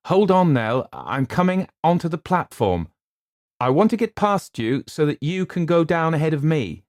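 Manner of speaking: high, loud urgent instruction; urgent call. A narrator's reading. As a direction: urge her on loudly. Luke calling on platform.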